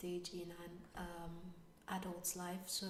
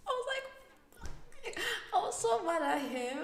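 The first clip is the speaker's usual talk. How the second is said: higher and louder, laughing through it; laughing indignation; 7 dB louder than usual. Real, not acted. Telling viewers about her husband's prank, laughing and indignant. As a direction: laugh as you complain about him. Laughing while recalling mock anger.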